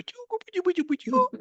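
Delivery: put-on very high cooing to a baby; cooing to a puppy; baby talk. Shown as a demonstration. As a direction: coo at a baby in your arms. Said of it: "a baby in your arms ... you go".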